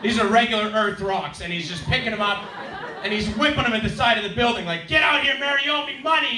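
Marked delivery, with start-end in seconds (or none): 4.9-6.4 high, loud mock demand; imitated yelling. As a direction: yell it up at the building like the rock thrower. Rock thrower yelling at the building.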